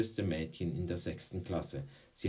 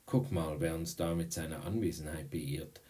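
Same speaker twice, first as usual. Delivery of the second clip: ordinary delivery, nothing marked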